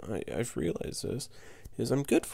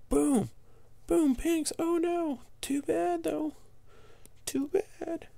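gleeful gloating; 13 semitones higher, slower than usual. Real, not acted. Player gloating over the opponent's popped pinks, high gleeful taunting. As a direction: crow over the popped balloons.

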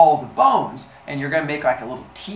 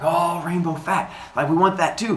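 eager, higher-pitched mock craving; excited; 6 semitones higher than usual. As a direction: play an over-excited crowd. Humans voiced as thrilled.

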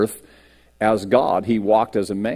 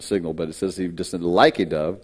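usual speaking voice

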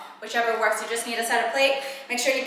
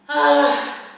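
relieved exhale; 2 semitones higher, 7 dB louder than usual. Real, not acted. Exhale after a hard set.

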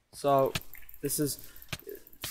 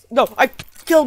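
loud frustrated shouting, pitched up; frustrated yell; 9 semitones higher, 11 dB louder, faster than usual. Real, not acted. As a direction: rant it out loud, disbelieving. Killed by own cobwebs, outburst.

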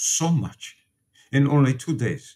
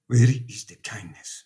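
normal talking voice

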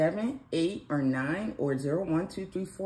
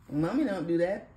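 normal talking voice

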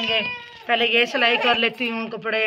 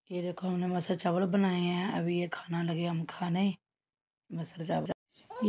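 hushed; 5 semitones lower, 8 dB quieter than usual. Real, not acted. Showing food quietly.

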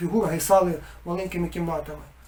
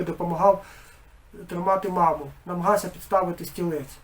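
usual speaking voice

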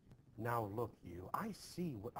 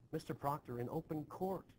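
ordinary talk

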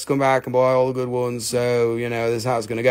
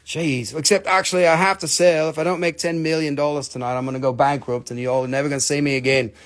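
usual speaking voice

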